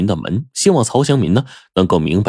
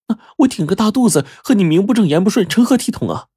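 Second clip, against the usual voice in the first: much higher, urgent complaining voice; anxious woman; 12 semitones higher, faster than usual. Acted. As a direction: press him hard, worried. Pregnant woman anxiously confronting lover. (着急的女声，音调很高)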